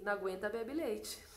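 a hint of the Holy Spirit, quietly teasing; Holy Spirit's voice. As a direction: deliver it like a gentle voice from above. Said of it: "o Espírito Santo me disse assim".

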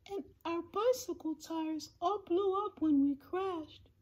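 high, soft little voice; small voice reporting troubles from far below; plaintive, tiny high-pitched mayor. A narrator's reading. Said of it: "voice of the mayor".

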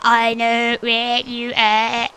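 slow, loud robotic monotone impression; Dalek. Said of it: "Best Dalek impression ever".

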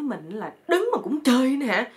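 lightly hammed-up outcry; mock indignant yell. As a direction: mimic someone's exasperated shout. Wife repeats the friend's outburst.

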